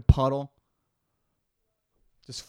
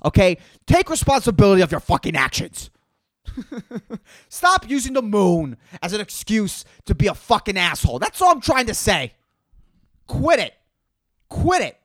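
loud, higher scolding rant; angry rant; 5 semitones higher, 4 dB louder than usual. Acted. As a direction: yell it like a fed-up scold. Ranting at full moon people.

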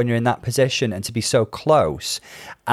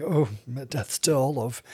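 slow, soft tragic diva; Norma Desmond; 7 dB quieter than usual. Acted. Sick contestant lurching around like a silent-film star, melodramatic. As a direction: languish theatrically, slow and hushed. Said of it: "glorious Norma Desmond, wasn't she?".

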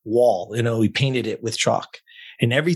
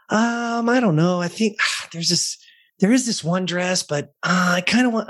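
hesitant client; 7 semitones higher than usual. Retold or imitated. Client answering hesitantly, voiced high.

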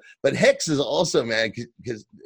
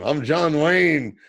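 mock John Wayne drawl; 4 semitones higher, 5 dB louder, much slower than usual. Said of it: "when he tells them".

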